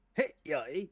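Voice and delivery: high squeaky character catchphrase; playful high-pitched character voice; impression. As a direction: pop it out like a game character. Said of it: "I do a lot of impressions sometimes".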